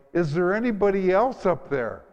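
high, loud yell with a laugh in it; punchline yell. Retold or imitated. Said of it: "and he yelled".

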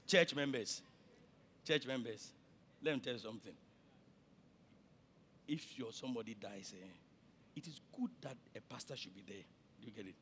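low, hushed, sober address; grave counsel. Real, not acted. Pastor cautioning church members about funerals, gravely and quietly. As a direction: drop right down in pitch and volume.

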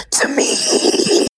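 mimicked king's command. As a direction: hag faking the king's call. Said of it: "her voice just turn to that of the king".

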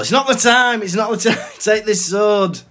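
high, loud mock outburst; exasperated shout. Sorting Hat rebuking Harry in battle, high mock shouting. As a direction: yell it urgently, close to laughing.

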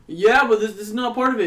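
object, flustered; protesting guy. Retold or imitated. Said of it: "he goes".

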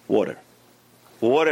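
ordinary delivery

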